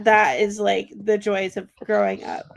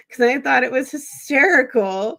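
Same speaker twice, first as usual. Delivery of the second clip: ordinary talk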